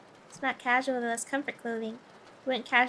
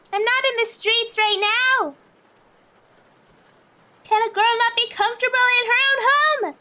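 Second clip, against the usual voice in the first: higher, louder, defensive protest; indignant; 8 semitones higher, 3 dB louder than usual. Real, not acted. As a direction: push back, half offended. Defending her outfit, indignant.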